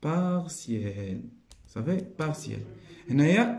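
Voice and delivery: usual speaking voice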